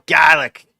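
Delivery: garlic said kalik, r dropped mid-word; Boston garlic. Shown as a demonstration. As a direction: swallow the r in the middle of garlic, loud. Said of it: "weird version of words is right in the center".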